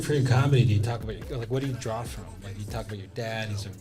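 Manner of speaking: ordinary delivery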